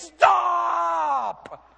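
sharp high shout of stop; frantic parent's stop; yelled stop. Retold or imitated. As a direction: shout to stop a child at the edge. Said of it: "You would yell at your kids".

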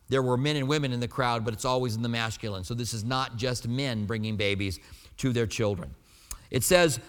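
normal talking voice

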